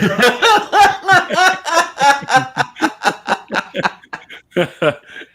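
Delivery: long laughing fit; loud high laugh. Real, not acted. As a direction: crack up loudly. Laughs at joke punchline.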